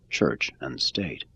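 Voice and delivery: ordinary delivery, nothing marked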